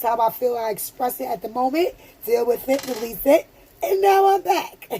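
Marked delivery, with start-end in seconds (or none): 3.8-5.0 laughing. Real, not acted. Laughing after her emotional moment.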